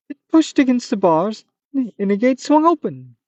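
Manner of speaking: narration still in the high monster voice; slipping into the creature pitch; leftover Manticore voice. Acted. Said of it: "Oh, it, it".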